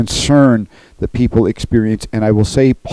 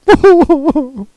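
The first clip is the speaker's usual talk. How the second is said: high laugh; 7 dB louder than usual. Real, not acted. Preacher laughing at warriors bawling like babies, a high laugh.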